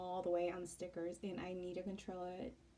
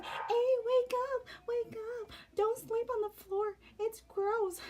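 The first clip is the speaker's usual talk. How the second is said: very high, loud, squeaky mock-mother-in-law; shrill it out like a fussing mom slapping him awake; mother-in-law's wake-up call; 8 dB louder than usual.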